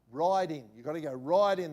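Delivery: firm, emphatic urging; insistent. Retold or imitated. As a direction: push her to check her pocket. Insisting to the woman.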